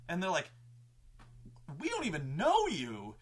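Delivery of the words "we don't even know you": imitating players' dismay, a little higher; mildly mock-appalled; disturbed players. Players disowning the game master, retold dismay. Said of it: "the party was really disturbed by this".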